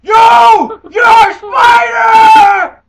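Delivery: slow, high yelling; screaming at a spider. Pretend. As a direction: bellow it at the spider.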